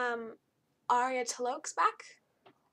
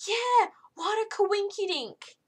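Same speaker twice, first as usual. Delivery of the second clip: much higher, louder, slow silly voice; mocking Elusive Man; 10 semitones higher, 9 dB louder, slower than usual. Elusive Man replying to Arya, silly high mock voice. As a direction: ham up a goofy chirpy villain. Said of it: "the Elusive Man's like".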